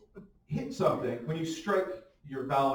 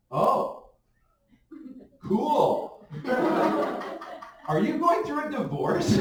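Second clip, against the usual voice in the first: higher, mock-concerned voice; mock pitying adult; 9 semitones higher, slower than usual. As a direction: voice an adult pitying him, pitched up. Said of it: "they're like".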